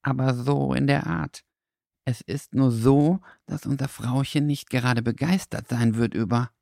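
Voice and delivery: an awkward little dog admitting trouble; sheepish dog. A narrator's reading. Dackel explains the owner will be upset, sheepish.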